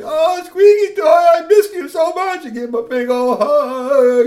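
very high, loud, slow cry of joy; overjoyed to see his friend; tearful joy. A narrator's reading. Three T hugging Squeaky Toy, overjoyed and squealing. Joyful reunion hug.

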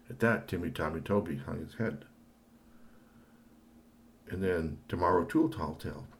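ordinary delivery, nothing marked

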